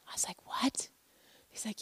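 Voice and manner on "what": barely audible disbelief; stunned whisper. Retold or imitated. Mom hearing of a death, stunned whisper. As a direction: whisper it as if the news stopped you. Mother stunned once more.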